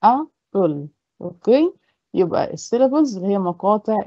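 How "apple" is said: "apple" split into "a", pause, "ple"; two separate beats in "apple"; syllable by syllable. Shown as a demonstration. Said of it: "صوت أو syllable لوحده".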